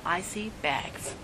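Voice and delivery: a hushed, slow reveal; slow knowing punchline. Retold or imitated. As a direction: deliver it like a quiet revelation. Friend delivers punchline about bags.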